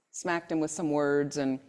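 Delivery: ordinary talk, nothing marked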